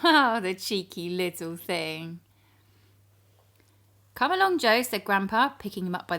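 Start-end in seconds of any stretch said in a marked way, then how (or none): 0.0-2.2 chuckled through; fond giggling storyteller; laughing fondly. A narrator's reading. 4.1-4.8 put-on high, loud old man call; firm but kindly grandpa. Grandpa scolds gently.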